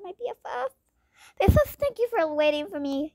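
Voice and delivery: ordinary talk, nothing marked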